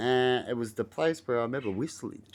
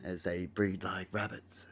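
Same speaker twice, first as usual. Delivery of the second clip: ordinary talk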